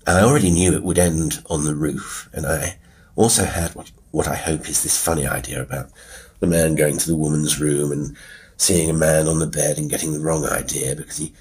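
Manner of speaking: ordinary talk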